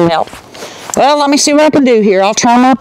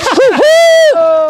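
triumphant whoop. Real, not acted. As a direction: whoop at the hit. Celebrating her hit.

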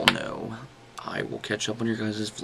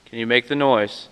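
loud call into the silence; calling out loudly; 4 semitones higher, 8 dB louder than usual. Real, not acted. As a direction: shout a hello to the ghost. Calling to spirits again.

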